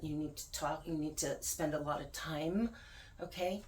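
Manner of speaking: usual speaking voice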